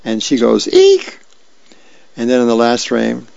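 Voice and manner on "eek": a short, very high squeal of horror; squealed eek. Acted. Girl squeals at her dad's top knot hairdo. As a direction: shriek it like a kid seeing a bad hairdo.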